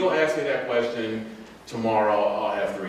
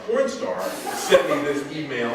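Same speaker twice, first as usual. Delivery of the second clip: laughing; 7 semitones higher, 3 dB louder, slower than usual. Real, not acted. Laughing about porn star email.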